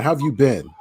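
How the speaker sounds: normal talking voice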